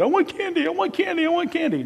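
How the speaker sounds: put-on high whining child begging for candy; whiny kid. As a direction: whine like a kid at the checkout. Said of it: "the lady at the checkout counter with the kid".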